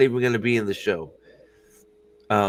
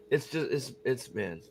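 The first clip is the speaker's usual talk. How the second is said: ordinary delivery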